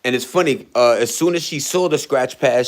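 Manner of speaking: ordinary delivery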